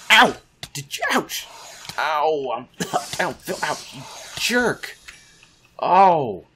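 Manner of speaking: high, loud ouches of pain, cut off mid-sentence; pained yelps. Real, not acted. Player hurt by a mob, yelping high and loud. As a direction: yelp as the hits keep landing.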